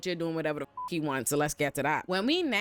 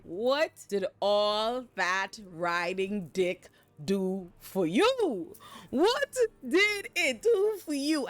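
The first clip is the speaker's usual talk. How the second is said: mocking taunt; much slower than usual. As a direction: rub it in, stretching every word. Mocking Alicent about her choices.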